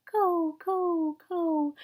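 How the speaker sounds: high, hooting owl cry; little owl call. A narrator's reading. Father swan recalls pond sounds for cygnets, owl call. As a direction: hoot each word high and drawn out. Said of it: "the little owl that says".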